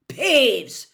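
loud, high, furious call; bellowed angry summons; angry yell. A narrator's reading. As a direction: yell it out sharply at a troublemaker. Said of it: "yelled an angry voice".

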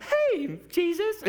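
loud, very high silly holler; comic high-pitched interrupting shout; yelling heckler. Acted. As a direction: call out squeakily from the crowd. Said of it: "That's how I imagine him sounding".

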